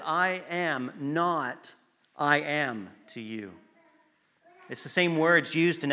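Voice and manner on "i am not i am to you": "I am not," held and slow, a pause, then "I am to you" softly; slow paused reading. Shown as a demonstration. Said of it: "it could also be read as".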